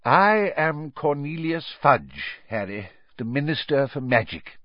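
a hint of an official introducing himself; lightly voiced Minister.

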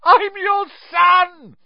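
drawn-out, very high desperate scream. A narrator's reading. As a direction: scream it up at your father. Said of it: "he screamed up at Crouch".

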